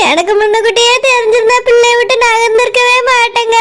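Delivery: ordinary delivery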